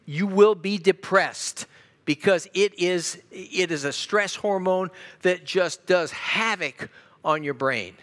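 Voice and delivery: slow, loud, hammering each word; emphatic preaching. Real, not acted. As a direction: drive it home slowly and loudly. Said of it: "preacher emphasising cortisol".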